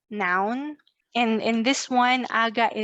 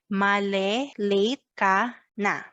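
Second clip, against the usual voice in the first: slow, word-by-word Taglish phrase; slow model phrase; 2 semitones lower than usual. Shown as a demonstration. Teacher says it together with learners, slowly. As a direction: leave space between the words. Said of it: "One more time".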